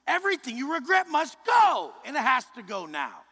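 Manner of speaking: sudden high shouted command; high, loud preaching shout. Real, not acted. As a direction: yell it at the crowd with force. Preaching climax.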